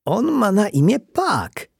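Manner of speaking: put-on high, drawn-out child's cry; slow, high-pitched announcement; excited shout. A narrator's reading. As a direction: shout the discovery like a little boy. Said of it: "krzyknął Jacek".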